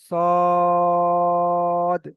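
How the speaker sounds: সদ slowly with rounded lips; round-mouth sad. Shown as a demonstration. Teacher showing full letter, slow round সদ. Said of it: "মুখ গোল করে পড়তে হবে".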